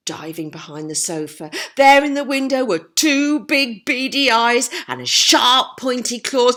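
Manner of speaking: usual speaking voice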